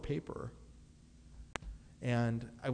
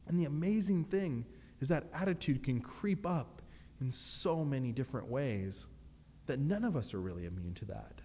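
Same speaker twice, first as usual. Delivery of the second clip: ordinary talk, nothing marked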